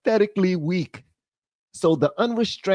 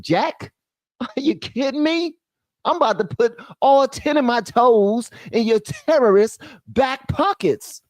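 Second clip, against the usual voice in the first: real laughing excitement, higher; laughing, pitched-up disbelief; laughing outburst; 6 semitones higher than usual. Real, not acted.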